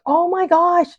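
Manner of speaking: high, breathless "oh my gosh"; amazed. Retold or imitated. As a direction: gasp it out in disbelief. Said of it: "I was like".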